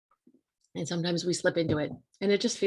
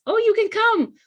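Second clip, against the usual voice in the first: high, loud sing-song "Oh, you can come"; mock cheery approval; 13 semitones higher, 8 dB louder than usual. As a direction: play a chirpy host happily saying yes. Mocking people's cheerful acceptance.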